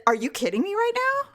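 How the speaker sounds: high-pitched mock disbelief; retold incredulous retort. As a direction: act out throwing disbelief back at mom. Retold row with mother.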